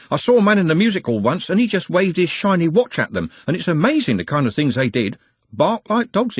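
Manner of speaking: quick, eager lad's voice; excited young man. A narrator's reading. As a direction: rattle it off with enthusiasm. Trev tells, excited.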